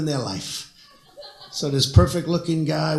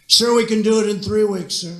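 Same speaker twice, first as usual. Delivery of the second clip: a general's emphatic claim, a touch louder; 6 semitones higher, 3 dB louder than usual. Retold or imitated. General assuring the president about isis, emphatic. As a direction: declare it proudly to the president. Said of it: "say it one more time".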